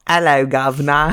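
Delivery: put-on British accent. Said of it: "a British accent".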